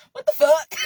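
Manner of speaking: loud, high, laughing yell; laughing shout. Real, not acted. Cracking up at own flubbed tongue twister, laughing shout.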